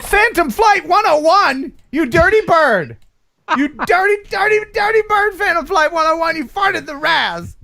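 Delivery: high-pitched mock outrage, words stretched; mock scolding. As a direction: scold the culprit in a loud, high comic voice. Mock scolding of a farting flyer.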